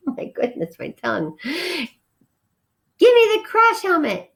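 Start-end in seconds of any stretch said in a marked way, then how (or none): 1.4-4.3 high, slow squeaky demand; demanding tiny passenger. A narrator's reading. Small passenger demanding the helmet, high and slow. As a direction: draw it out like a bossy little mouse. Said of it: "demanded the passenger".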